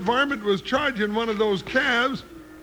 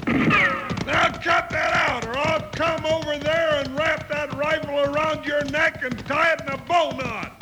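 angry shout; 4 semitones higher than usual. Acted. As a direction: bark the threat across the yard. Threatening man holding a rifle.

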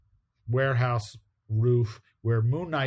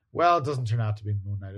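ordinary delivery

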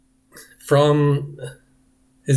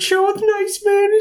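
very high squeaky voice with a crying edge; Stuart's mom; slower than usual. Retold or imitated. Mother urges Stuart to show the nice man, squeaking. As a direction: coax it in a shrill sing-song. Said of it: "I was thinking in my head".

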